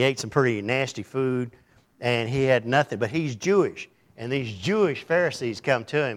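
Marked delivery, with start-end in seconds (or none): none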